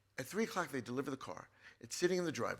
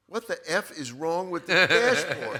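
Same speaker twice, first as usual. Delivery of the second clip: slow, loud mock outburst, higher, with a laugh in it; furious mother; 5 semitones higher, 14 dB louder, slower than usual. Mother discovers the dashboard, imitated loud angry yell. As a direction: retell mom yelling at the wrecked dashboard, laughing. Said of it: "she goes".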